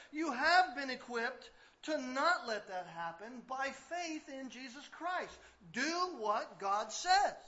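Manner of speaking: loud, slow, emphatic preaching; fiery pastor pressing the congregation; preaching at full force. Acted. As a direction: drive the point home from the pulpit.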